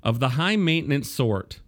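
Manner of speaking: high-pitched quoted complaint; colorful complaint. Retold or imitated. Host quoting a media critic's gripe about Pulitzers, mock-indignant complaint. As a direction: read out a columnist's grievance with mock indignation. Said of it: "Wempel colorfully complained".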